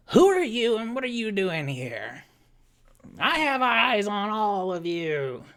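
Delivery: wary half-orc confronting an intruder; stern threat. Acted. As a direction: interrogate the stranger.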